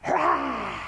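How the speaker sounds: long demonstrated lion roar, falling away; open-mouthed "rah" roar. Shown as a demonstration. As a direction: roar like a mad lion for the kids. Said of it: "I want you to roar like a lion".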